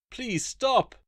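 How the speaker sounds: high, loud cry of please stop; a girl screaming at the swarm to stop; desperate plea. Acted. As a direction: plead in panic. Girl begs attacking fairies.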